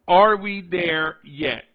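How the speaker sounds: half-speed, loud opening on "are"; slow, weighty question, words drawn apart; slow-played. Shown as a demonstration. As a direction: stretch out each word of the question. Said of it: "slow play it a little bit".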